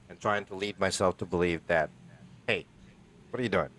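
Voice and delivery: normal talking voice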